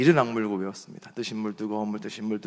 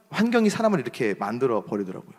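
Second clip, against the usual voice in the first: usual speaking voice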